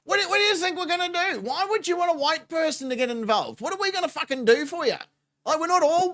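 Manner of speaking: high, fast and scoffing; incredulous rant. Real, not acted. Mocking the man's demand, fast and high. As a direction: fire the questions in disbelief.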